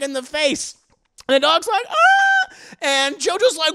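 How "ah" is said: very high, loud mock dog yelp. The kicked dog, high loud yelp.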